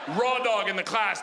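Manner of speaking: ordinary delivery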